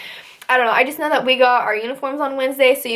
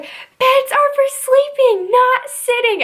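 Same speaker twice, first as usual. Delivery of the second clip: much higher, slow, drawn-out scolding; stern drill instructor; 13 semitones higher, slower than usual. Retold or imitated. Instructor scolding a recruit sitting on her bed. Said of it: "she walked by and she was like".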